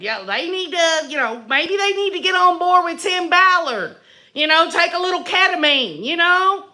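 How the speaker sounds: normal talking voice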